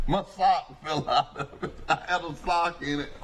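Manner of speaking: real laugh running through the words; amused, laughing at your own mishap. Real, not acted. Laughs telling his hat mishap.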